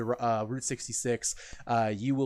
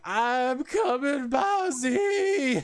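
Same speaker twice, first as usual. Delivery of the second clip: much higher, slow stretched yell of a name; retold scream; 14 semitones higher, 5 dB louder, much slower than usual. Retelling support player's comms as he rushes to help: stretched scream. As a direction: yell it long as you rush in. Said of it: "he was just screaming".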